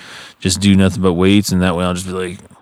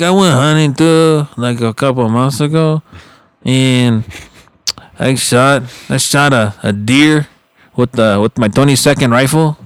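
puffed-up tough-guy brag, lightly voiced; acting tough; 5 semitones higher than usual. Uncle bragging at the reunion, mild mock bravado. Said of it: "tries to act all tough".

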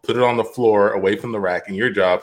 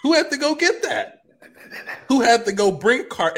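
high strained rhetorical question; 11 semitones higher than usual. Acted.

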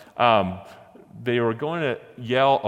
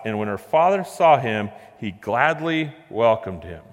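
louder, measured Bible reading; scripture reading; 3 semitones higher, 3 dB louder than usual. Acted. Preacher reading Judges nineteen to the congregation, measured. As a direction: read the passage with weight. Reads scripture passage aloud.

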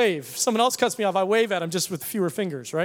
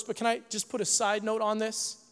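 ordinary talk

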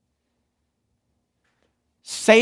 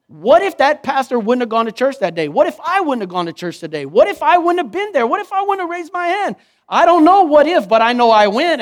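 higher, much louder, building preacher's cry; preaching at full force; 7 semitones higher, 6 dB louder than usual. Acted. Preacher challenges the congregation, fervent full-voiced preaching. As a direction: rise to a fervent sermon peak.

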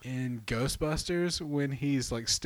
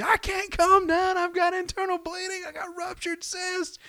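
high, loud, crying mock wail; imitated hysterical scream; 5 dB louder than usual. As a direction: wail it like a hysterical woman in pain. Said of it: "she's screaming".

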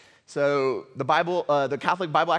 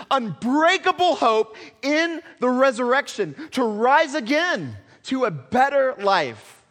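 rising, loud, higher preached climax; preaching at full force; 7 semitones higher, 6 dB louder than usual. Acted. Pastor lifting the congregation toward hope, preached loudly. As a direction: drive it home to the congregation.

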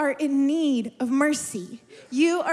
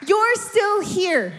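loud, slow, higher preaching cry; preacher shouting encouragement; shouted exhortation; 6 semitones higher, 3 dB louder, slower than usual. Real, not acted. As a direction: drive it home to the crowd. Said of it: "Is this encouraging someone?".

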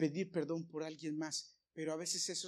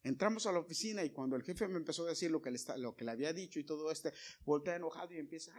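usual speaking voice

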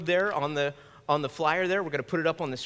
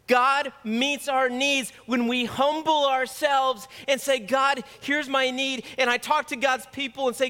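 higher, louder, jubilant preaching; joyful proclamation; 6 semitones higher, 3 dB louder than usual. Real, not acted. Pastor tells church all bills are paid, jubilant and loud. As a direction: announce the good news with rising joy.

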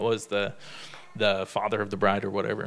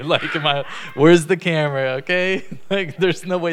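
laughing loudly; 6 semitones higher, 9 dB louder than usual. Real, not acted. Laughing at imagined prank.

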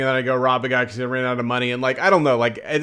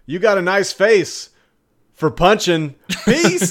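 slow, higher mock-tough insult; smug taunt; 7 semitones higher, 7 dB louder, slower than usual. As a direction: taunt them like a cocky jerk. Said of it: "You could be like".

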